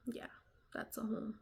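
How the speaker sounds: whispered aside, no voice. Real, not acted. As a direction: drop to a whisper for the throwaway line. Whispered aside, repeating earlier phrase.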